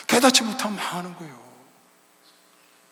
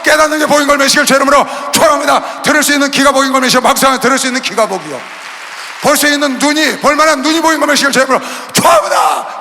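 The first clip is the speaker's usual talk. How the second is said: loud, ringing pulpit benediction; shouted proclamation of blessing; 5 semitones higher, 10 dB louder than usual. Acted. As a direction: pronounce the blessing over them. Benediction preached loudly.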